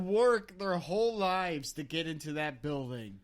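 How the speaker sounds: loud, indignant scolding; mock outrage. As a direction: scold as if scandalized. Mock outrage over the vomiting.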